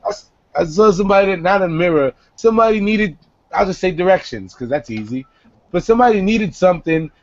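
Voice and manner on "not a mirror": normal talking voice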